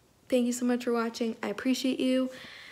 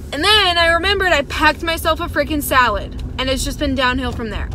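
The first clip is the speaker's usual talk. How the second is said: louder, annoyed complaint; exasperated outburst; 4 semitones higher, 6 dB louder than usual. Real, not acted. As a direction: vent about the salad. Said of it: "Everything is making me angry".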